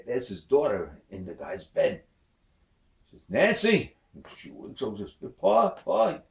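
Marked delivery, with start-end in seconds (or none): 3.3-4.0 father's sharp call of her name; startled father calling out. Acted. Father calls daughter awake. 5.4-6.2 high, loud startled cry; jolted awake, cry out to him. Acted. Daughter wakes startled.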